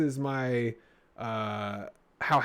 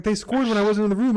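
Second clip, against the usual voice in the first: lightly voiced wife, higher; wife's high protest; 9 semitones higher, 5 dB louder than usual. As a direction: quote her protest, pitched up. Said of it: "she was like".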